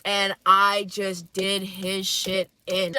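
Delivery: ordinary talk, nothing marked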